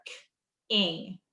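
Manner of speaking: "k" then "ing", two separate sounds; split onset and rime. Shown as a demonstration. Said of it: "first part of the word and the end".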